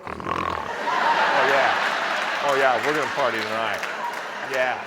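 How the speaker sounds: deep, fast party voice, hammed-up; mock party animal, rumbling low; party guy. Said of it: "and going".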